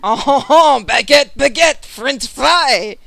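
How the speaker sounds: loud, laughing mock French accent. GM joking with a player about French words, laughing in a mock accent.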